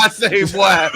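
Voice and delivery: laughing, lightly voiced rooster "I say, boy"; a half-laughed cartoon rooster; Foghorn Leghorn. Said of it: "that's what The Rock was sounding like".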